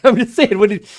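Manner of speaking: high, loud self-defence; loud protest. Real, not acted. Game master defends the question to teasing players, protesting loudly. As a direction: insist loudly over the laughter.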